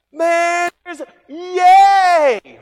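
high, loud, stretched-out joyful yay; ecstatic cheer. Acted. Preacher acting out the crowd rejoicing over Lazarus, stretched joyful cheer. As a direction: throw your voice up in celebration.

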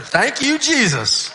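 loud, high burst of grateful relief; relieved exclamation. Retold or imitated. Pastor thanking Jesus after Reuben's praise, elated. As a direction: cry out thanks in delighted relief.

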